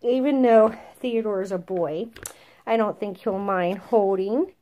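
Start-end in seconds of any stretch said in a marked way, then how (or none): none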